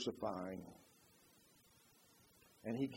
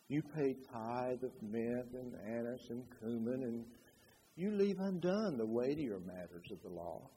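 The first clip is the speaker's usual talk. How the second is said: very soft, low, unhurried scripture reading; hushed and gentle, no force at all; mild, toneless rebuke; 6 semitones lower, 11 dB quieter than usual. Shown as a demonstration. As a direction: quote the rebuke meekly, with long pauses. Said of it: "I really don't think he was saying".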